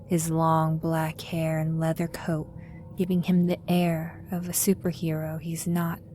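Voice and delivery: normal talking voice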